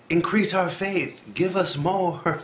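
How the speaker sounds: high, drawn-out mock begging; whiny, needy plea, hammed up; pleading demand. Imitates disciples begging for more faith.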